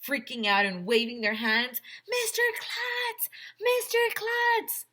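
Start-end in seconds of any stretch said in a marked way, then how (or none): 2.1-3.3 long, much higher call, slow. Acted. Kids call up to Mister Klutz, long high call. Kids calling to parachuting principal. 3.6-4.9 much higher, louder repeated call, slow. Acted. Kids call Mister Klutz a second time, louder high call. Kids calling to parachuting principal.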